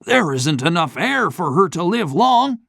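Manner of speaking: the banker begging for help; shaky voice. A narrator's reading. Banker despairing.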